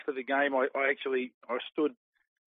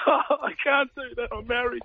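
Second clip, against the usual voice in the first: much higher, mock-plaintive whine; whiny married mate; 10 semitones higher than usual. Married teammate lamenting he can't go drinking, hammed-up whine. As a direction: play the hard-done-by husband. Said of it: "He goes".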